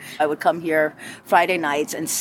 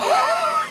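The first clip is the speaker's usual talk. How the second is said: short, very high real laugh; bright high laugh at herself; high burst of laughter. Real, not acted. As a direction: let a high giggle burst out. Laughing at own story.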